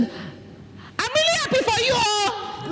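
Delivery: very high, soft and slow; strained, unnatural happiness; weird-sounding. Shown as a demonstration. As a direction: squeak it out thin and drawn. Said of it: "That sounds weird".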